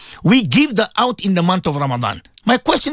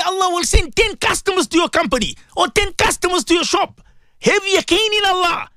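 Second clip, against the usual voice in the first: high, raised sermon voice, close to a shout; preaching at full force; 9 semitones higher than usual. Real, not acted. Preacher urging radio listeners to give, raised and high. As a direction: plead hard with the listeners to help.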